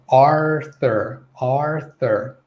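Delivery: Arthur with a clear R before TH; careful Arthur, long R-coloured first vowel; American enunciated R. Shown as a demonstration. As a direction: pronounce the R in Arthur firmly. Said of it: "they'll enunciate that R".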